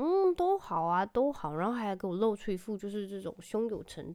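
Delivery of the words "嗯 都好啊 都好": son's breezy reply. Retold or imitated. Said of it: "然后就开始说".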